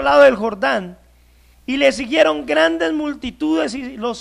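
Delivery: loud, declaiming Bible reading; preaching Scripture reading. Acted. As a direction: proclaim the verses to the whole hall. Reads Scripture aloud to congregation. (lectura bíblica proclamada con fuerza ante la congregación)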